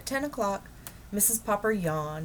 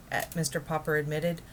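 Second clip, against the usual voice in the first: usual speaking voice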